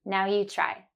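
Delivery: normal talking voice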